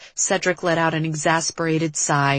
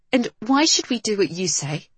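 higher-pitched, biting challenge; 4 semitones higher than usual. A narrator's reading. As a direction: challenge her with bite. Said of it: "Kat's voice turned from sensible to biting".

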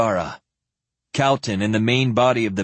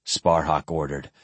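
usual speaking voice

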